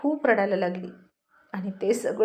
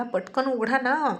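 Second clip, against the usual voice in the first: plain reading voice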